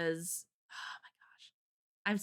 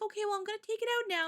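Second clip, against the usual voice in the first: quick nurse imitation; 12 semitones higher, much faster than usual. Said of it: "She's like".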